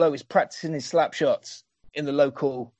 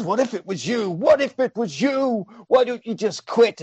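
mock pleading; 8 semitones higher than usual. Jesse pleads in retold scene.

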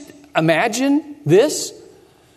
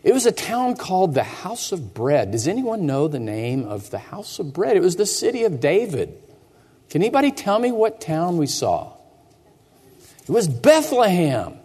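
ordinary delivery

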